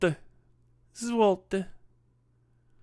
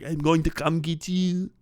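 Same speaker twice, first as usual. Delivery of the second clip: put-on miffed Central European accent; 3 semitones higher than usual. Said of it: "a little bit miffed about it".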